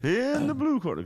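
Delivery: high, slow announcer call; ring announcer. Retold or imitated. Joining the bit as fight announcer, high drawn announcer.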